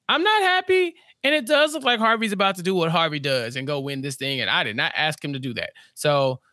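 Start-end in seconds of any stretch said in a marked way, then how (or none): 0.1-1.0 much higher, hammed-up complaint; squeaky-high retold grumble; mock displeasure. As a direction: repeat it way up high in mock protest. Paul's complaint repeated, escalating.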